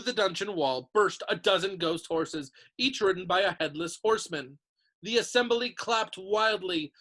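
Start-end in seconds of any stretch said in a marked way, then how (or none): none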